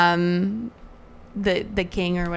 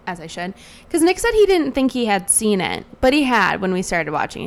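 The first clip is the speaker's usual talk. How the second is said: ordinary delivery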